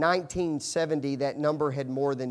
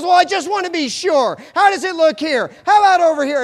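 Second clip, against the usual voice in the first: high, loud hammed-up wife; excited wife imitation; 16 dB louder than usual. Husband playing his wife insisting she just wants to be sure, loud and high. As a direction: shout it as the eager wife. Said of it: "She goes".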